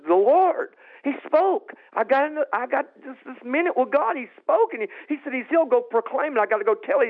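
high, urgent, elated testimony; reenacting breathless joy at a miracle; excited retelling. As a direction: tumble out the good news, pitched right up. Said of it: "I'm all excited".

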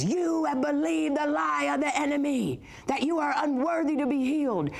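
high, sustained declaring voice; commanding prayer minister. Retold or imitated. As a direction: imitate a preacher confronting lies in prayer. Imitates man declaring over her.

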